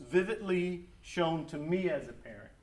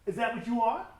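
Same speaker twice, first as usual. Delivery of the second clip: stern father; 6 semitones higher, 7 dB louder, faster than usual. Retold or imitated. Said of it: "he would say".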